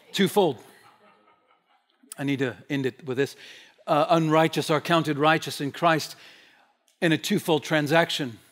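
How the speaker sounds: usual speaking voice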